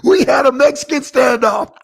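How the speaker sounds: loud laugh over the words; bursting out laughing; laughing shout. Real, not acted.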